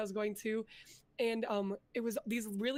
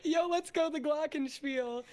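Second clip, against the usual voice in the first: excited cheer; 5 semitones higher than usual. Real, not acted. Cheering co-host's band instrument.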